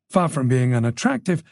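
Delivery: usual speaking voice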